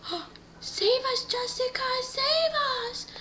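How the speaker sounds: very high, squealed plea; mock desperate pleading. Captive family begs the girl to save them, high pleading. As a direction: beg for rescue in a high put-on voice.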